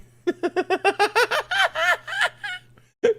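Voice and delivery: loud high-pitched laughter. Real, not acted. Laughing about the embarrassing song clip. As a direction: burst out laughing.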